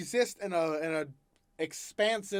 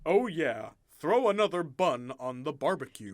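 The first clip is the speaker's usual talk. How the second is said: American suburban middle-aged man; imitated American accent. Playing a suburban dad at the barbecue, mock American accent. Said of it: "like, middle-aged male".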